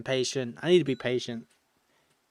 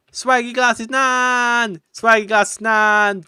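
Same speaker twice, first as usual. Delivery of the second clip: slow, drawn-out grand announcement, higher and louder; announcer; 8 semitones higher, 9 dB louder, much slower than usual. Acted. Streamer announcing the grandma villager, slow drawn-out fanfare. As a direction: announce her name like a showman.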